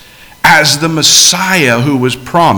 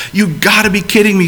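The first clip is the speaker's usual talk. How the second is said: higher, quick, disbelieving outburst; incredulous exclamation; 6 semitones higher, faster than usual. Retold or imitated. Abraham reacting to God's promise, higher and quick in disbelief. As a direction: blurt it out in amazement, a bit fast. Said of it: "says Abraham".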